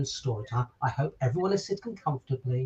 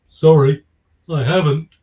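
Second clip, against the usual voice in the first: sleepy lion; 12 dB louder, slower than usual. Acted. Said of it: "The lion was very hot and sleepy".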